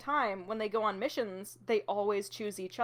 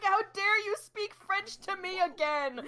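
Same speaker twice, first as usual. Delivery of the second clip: high, slow, outraged demand; indignant outrage; 10 semitones higher, slower than usual. Retold or imitated. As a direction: sputter with offended fury. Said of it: "It's like".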